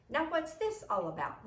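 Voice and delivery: stern principal. A narrator's reading. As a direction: demand an explanation like a stern principal. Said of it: "the principal wanted to know".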